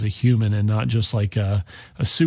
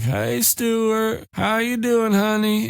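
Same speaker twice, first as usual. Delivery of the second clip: higher, put-on voice of an old woman; 10 semitones higher, slower than usual. Mother Abigail answers Stu.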